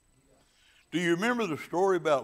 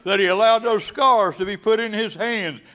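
preach; 6 semitones higher, 11 dB louder than usual. Acted. Preacher building to full force.

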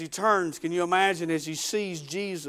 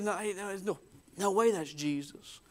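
hushed denial; 8 dB quieter than usual. Acted. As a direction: refuse to believe it, under your breath. Simon refuses to believe it.

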